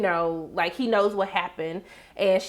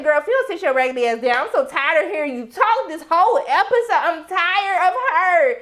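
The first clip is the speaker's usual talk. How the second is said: sassy telling-off; 6 semitones higher than usual. Retold or imitated. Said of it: "I said".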